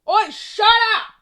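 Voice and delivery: very loud, high Australian shout; sudden Australian holler at barking dogs; Australian yell. Real, not acted. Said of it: "Had to go Australian there".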